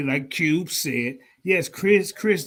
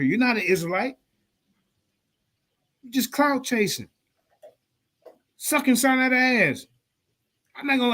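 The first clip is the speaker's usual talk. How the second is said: ordinary talk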